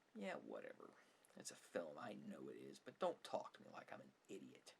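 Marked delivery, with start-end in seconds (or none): none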